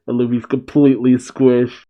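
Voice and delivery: ordinary delivery, nothing marked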